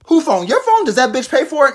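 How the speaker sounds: high, loud indignant mom; furious mother defending her kid, high and loud; outraged mom. Acted. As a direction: demand answers, voice shooting up. Mom outraged about teacher.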